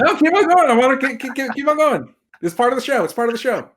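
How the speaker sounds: high, loud joking protest; playful insistence. Real, not acted. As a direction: insist gleefully that it's part of the show. Insists on ruining names.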